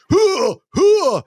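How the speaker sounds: high loud hoo hoo posturing; tough guy grunts. Retold or imitated. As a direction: pump yourself up before a fight. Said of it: "the typical tough guy stuff".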